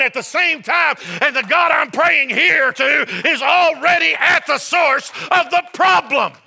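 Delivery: loud, high-pitched sermon building in force; full-force pulpit delivery; impassioned preaching. Real, not acted. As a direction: preach it hard, driving each phrase to the end.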